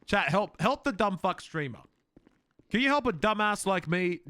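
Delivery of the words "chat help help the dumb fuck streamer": ordinary delivery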